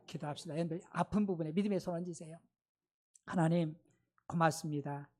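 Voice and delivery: pastor praying over the congregation, hushed and earnest; prayer leading. Acted. Leads closing prayer. (낮고 경건한 기도 인도)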